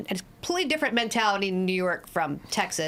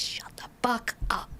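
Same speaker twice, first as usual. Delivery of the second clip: retold hushed rebuke; 5 dB quieter than usual. Friend to complainer in hot yoga class, hushed rebuke. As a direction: whisper it as an order to be silent.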